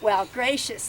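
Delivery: normal talking voice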